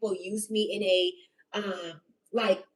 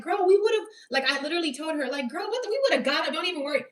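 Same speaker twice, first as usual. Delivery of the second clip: much higher, eager, brushing it off; excited reassurance; 7 semitones higher, faster than usual. Retold or imitated. Telling her friend Jasmine, reassuring her in a high, eager rush. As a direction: gush like it's no big deal at all. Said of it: "And I was all like".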